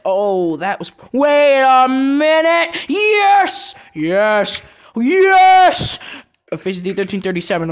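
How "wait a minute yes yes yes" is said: high, loud drawn-out cheer; elated cheering. Real, not acted. Gamer celebrating a lucky kill. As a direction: shout in triumph. Sudden win.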